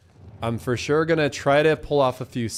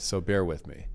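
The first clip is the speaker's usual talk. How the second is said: ordinary talk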